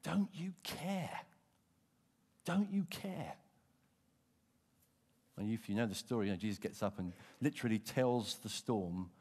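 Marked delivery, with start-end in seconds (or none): 0.0-3.4 slow, drawn-out pleading cry; desperate plea. Retold or imitated. Disciples waking Jesus in the storm, slow desperate plea. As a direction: plead slowly in fear. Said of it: "they wake him up and say".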